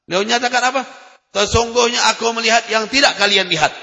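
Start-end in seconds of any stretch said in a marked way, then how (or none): 1.3-3.7 translation declaimed higher and louder; forceful translation. Shown as a demonstration. Preacher proclaims the Prophet's words to listeners, forceful. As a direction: proclaim it to the whole hall. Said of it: "Rasulullah menyatakan".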